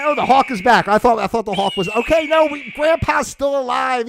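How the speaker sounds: hammed-up relief that Grandpa lives; mock alarm. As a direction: play it like spotting a vulture over Grandpa's house. Acting out alarm at hawk.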